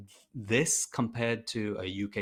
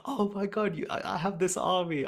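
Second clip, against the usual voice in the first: high, breathless fangirl rush at the cosplayer; gushing fan excitement; 7 semitones higher than usual. Retold or imitated. Player gushing to a Dire Avenger cosplayer, excited and high. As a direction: squeal it like meeting your hero. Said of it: "I was just like".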